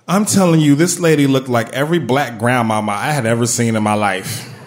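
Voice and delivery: ordinary talk, nothing marked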